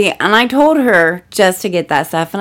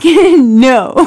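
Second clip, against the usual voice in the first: loud firm no; 4 semitones higher, 7 dB louder than usual. Retold or imitated. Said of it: "I'm like".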